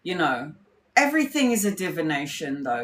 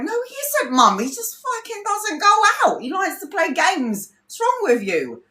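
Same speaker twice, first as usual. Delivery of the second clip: much higher pitch, incredulous protest; exasperated; 11 semitones higher than usual. Retold or imitated. Speaker retorting to her mum, high exasperated protest. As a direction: scold mum in disbelief.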